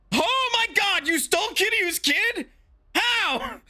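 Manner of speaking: very high, squeaky shocked gasp; shocked thug voice. Acted. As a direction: shriek it like a panicked goon. Voicing shocked game thug.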